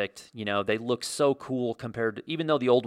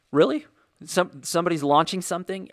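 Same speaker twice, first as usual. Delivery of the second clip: much higher and louder, imitating disbelief; retold surprise; 8 semitones higher, 4 dB louder than usual. Telling viewers how he reacted to the launch, mock surprise. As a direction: play the stunned husband finding the launch on. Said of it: "And I'm like".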